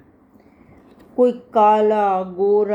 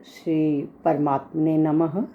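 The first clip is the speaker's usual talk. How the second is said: slow, low Sanskrit invocation chant; recitation; 5 semitones lower, slower than usual. Acted. Teacher opening the Gita class with a slow chant. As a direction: intone the opening prayer slowly.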